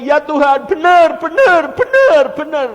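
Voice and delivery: high, very loud impassioned shouting; fervent cried-out reply. Retold or imitated. Weeping preacher answers God that it is true, shouting fervently. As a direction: cry out each affirmation at full force.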